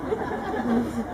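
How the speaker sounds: a short warm laugh; fond laugh. Real, not acted. Uncle at wedding laughs recalling the boy, fond laugh. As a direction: let a laugh escape.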